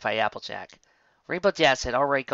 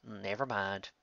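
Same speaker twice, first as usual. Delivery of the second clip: deflated; 3 semitones lower, 11 dB quieter, slower than usual. A narrator's reading. Backing down quietly.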